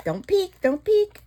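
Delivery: high teasing don't peek; sing-song warning to the viewers; high playful tease. Real, not acted.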